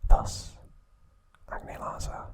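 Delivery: ordinary talk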